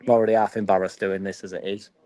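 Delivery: ordinary talk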